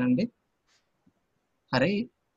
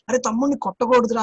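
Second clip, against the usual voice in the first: higher-pitched imitated scolding; admonishing quote; 6 semitones higher, 5 dB louder than usual. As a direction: voice a raised, lightly acted admonition. Voicing Jesus's command to a child.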